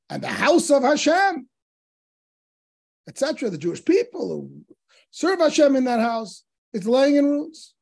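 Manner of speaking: mildly acted outrage; retold indignation. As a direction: argue it as the aggrieved sages, pitch lifted. Sages' indignant argument in retold debate.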